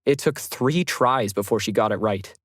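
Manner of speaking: plain reading voice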